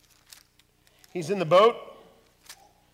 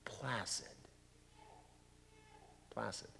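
hushed; 4 semitones lower, 19 dB quieter than usual. Acted. The sea gone calm.

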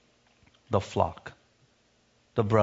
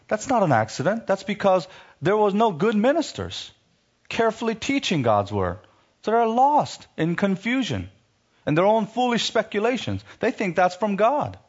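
much higher, impassioned preaching; preaching at full force; 8 semitones higher than usual. Acted. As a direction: drive it home like a fiery preacher.

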